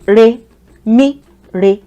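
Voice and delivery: "Re, mi, re" as separate tones, middle one up; "Re, mi, re" spaced out for repeating; tone drill. Shown as a demonstration. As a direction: step up on mi, back down on re. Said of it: "Try to pronounce after me".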